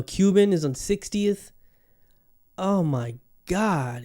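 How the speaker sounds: ordinary talk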